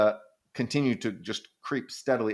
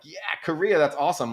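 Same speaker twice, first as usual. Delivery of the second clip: higher, bright-eyed gush; mock enthusiastic youth; 7 semitones higher than usual. Young Japanese friends raving about Korea, mock enthusiasm. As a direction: imitate a young fan cheering. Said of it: "they're like".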